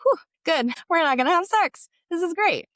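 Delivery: high, loud burst of relief; retold relief. Student relieved her teacher isn't after sex, high and loud. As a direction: exclaim it with a big exhale of relief.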